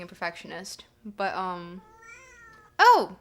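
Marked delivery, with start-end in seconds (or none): 2.8-3.2 sudden loud oh, caught off guard; surprised gasp. Real, not acted. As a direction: react like a surprise just landed. Surprised by a new sub.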